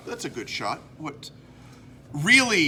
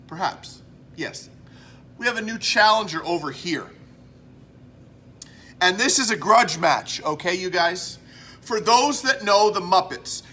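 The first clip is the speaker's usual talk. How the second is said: usual speaking voice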